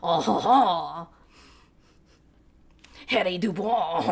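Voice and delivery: put-on silly slow French accent with a laugh; mock French accent, deliberately dumb. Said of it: "I can kinda do a French ass-, accent".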